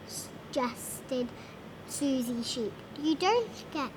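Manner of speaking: ordinary delivery, nothing marked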